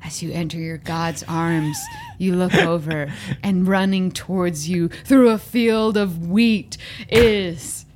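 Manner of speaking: solemn death narration. Acted. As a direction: narrate a hero's death, low and unhurried. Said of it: "you gotta be like".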